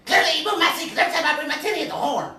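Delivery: very high, loud Rasputia voice; Rasputia impression. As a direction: bellow it like Rasputia. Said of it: "Do the roar".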